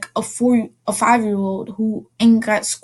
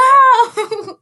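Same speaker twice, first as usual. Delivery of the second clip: squeaky little laugh; high childish giggle; 13 semitones higher, 6 dB louder than usual. Retold or imitated. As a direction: giggle like a toddler.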